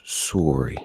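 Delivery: SORRY with an oo, "soo-ree"; imitated Canadian sorry. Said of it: "he was imitating you".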